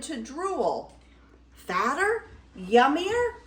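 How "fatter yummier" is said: high, rising greedy questions; mild shout. Acted. Troll drooling over a fatter goat, excited mild shout. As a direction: ask with mounting appetite, pitched up. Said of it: "he shouted".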